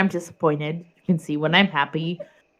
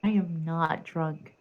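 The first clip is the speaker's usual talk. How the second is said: careful enunciation; 4 dB quieter than usual. Real, not acted. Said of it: "you are trying very hard to enunciate".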